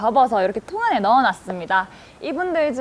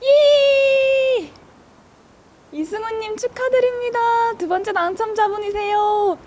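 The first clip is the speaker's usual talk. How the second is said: hyped giveaway announcement; 12 semitones higher than usual. Real, not acted. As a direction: announce the winner with hype. Announcing second winner.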